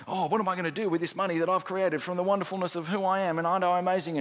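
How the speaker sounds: high, rapid mock self-admiration; self-absorbed rich man. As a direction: parody a man marvelling at himself.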